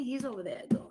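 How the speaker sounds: hushed whisper so he won't hear. Real, not acted. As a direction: whisper it under your breath. Husband asleep nearby, speaking softly.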